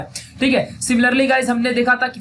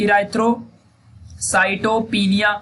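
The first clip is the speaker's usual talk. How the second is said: slow dictation; much slower than usual. Shown as a demonstration. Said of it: "वहां slow बोलना चाहिए".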